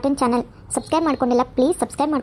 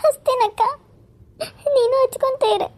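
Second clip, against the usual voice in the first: put-on high, teary young woman's voice fighting sobs; tearful younger sister; 10 semitones higher than usual. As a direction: say goodbye to her elder sister through tears.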